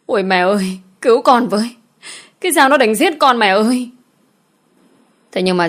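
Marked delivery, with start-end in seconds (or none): 0.1-3.9 desperate cry, higher and louder; screaming for help. Acted. Beaten maid screams to her mother for help, panicked shout. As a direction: wail for your mother to save you. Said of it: "gào lên kêu cứu".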